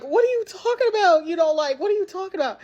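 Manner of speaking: high-pitched mock confusion; acted-out innocent disbelief; imitated bafflement. Cousin's puzzled reply, retold.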